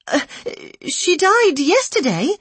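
high, loud horrified question. A narrator's reading. Wife learning the date of death, horrified. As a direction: disbelief breaking out.

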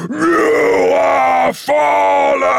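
high, slow, monstrous roaring shout; demon roar. Acted. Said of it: "roared the demon".

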